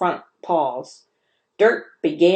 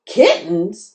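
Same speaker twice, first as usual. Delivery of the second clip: squeaked in horror; 5 dB louder than usual. A narrator's reading. Mouse Ralph squeaking at the dog in horror. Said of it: "squeaked Ralph in horror".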